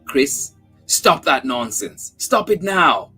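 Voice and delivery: firm, rising scold; mother snapping at her child to stop crying; stern scolding. A narrator's reading. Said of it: "I struck her sharply".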